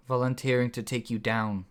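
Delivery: ordinary delivery, nothing marked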